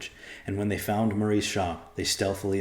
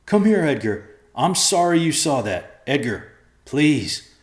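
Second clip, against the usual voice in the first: pleading; 7 semitones higher, 7 dB louder than usual. A narrator's reading. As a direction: beg him to come back. Father pleads with son.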